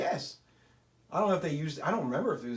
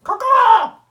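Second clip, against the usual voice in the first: loud high caw-caw cry; bird caw; 15 dB louder than usual. Pretend. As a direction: call out like an eagle. Eagles fan bird call.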